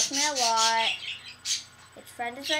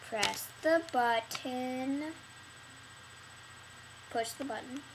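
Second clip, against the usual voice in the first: slow deadpan; 8 dB quieter, slower than usual. Pretend. Slow mock command.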